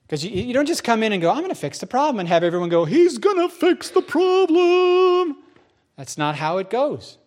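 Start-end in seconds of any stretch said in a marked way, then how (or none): 1.3-2.2 higher-pitched, brief self-assured declaration; confident announcement. Acted. Newcomer tells everyone he will fix things, confident. 2.9-5.4 very high, drawn-out, loud mock rejoicing; mock crowd cheer. Everyone hails the man fixing things, mock cheering. Acting everyone's imagined adoring reply.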